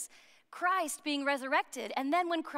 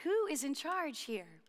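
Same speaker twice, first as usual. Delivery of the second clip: slow, softer, earnest asking; hushed, heartfelt question in the usual pitch; genuine question; 5 dB quieter, slower than usual. Acted. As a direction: ask it sincerely, quietly and slowly. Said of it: "very genuine question".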